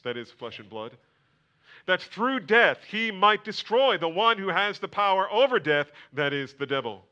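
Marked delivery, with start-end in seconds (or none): 1.8-7.1 loud, high proclamation; fervent preaching. Acted. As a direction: drive it home to the congregation. Preaching at full force.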